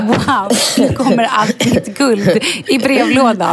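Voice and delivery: slow, dreamy mock delight; fake-amazed fantasy with a laugh; mock dreamy wow. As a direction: drawl it like a greedy daydream. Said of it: "det är ju första tanken man tänker".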